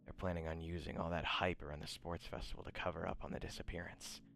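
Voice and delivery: understated conspirator giving orders; a hint of a scheming handler, in measured phrases; lightly voiced villain on phone. Game master voices villain on phone.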